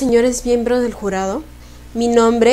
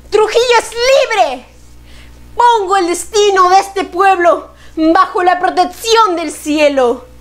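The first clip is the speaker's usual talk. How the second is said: high, ringing declaration to a crowd; proclamation; 8 semitones higher than usual. Acted. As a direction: proclaim it like a public decree. Proclaiming independence in the poem.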